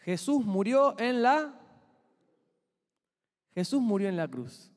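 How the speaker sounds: ordinary talk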